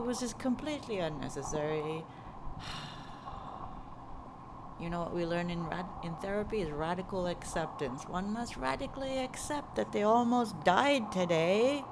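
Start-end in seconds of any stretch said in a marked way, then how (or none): 2.1-4.8 long, soft exhale; exasperated sigh. Real, not acted. Cyclist fed up with a car squeezing past, exasperated long sigh. 8.1-11.8 dramatic, higher and swelling louder; a bit dramatic. Real, not acted. Cyclist preaching radical acceptance after a near miss, dramatically. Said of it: "Might be a bit dramatic".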